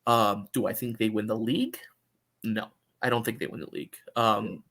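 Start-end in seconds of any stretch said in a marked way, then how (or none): none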